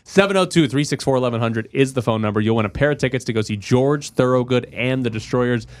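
hyped giveaway announcer read; radio contest promo. Acted. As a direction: sell the ticket contest.

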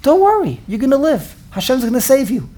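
high, bright mock reassurance; lightly hammed-up comforting visitor; reassuring visitor. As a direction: cheer the patient up in a high voice. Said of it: "what does the person say to the choyle".

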